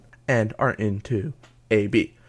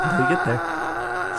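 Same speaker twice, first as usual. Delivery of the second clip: squeaky voice; 3 dB quieter, slower than usual. Acted. Odd voice mid reading.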